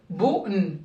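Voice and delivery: "boun" split into bou, then n; segmented syllable. Shown as a demonstration. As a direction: leave a small gap before the n of "boun". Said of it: "Comment ça se prononce?".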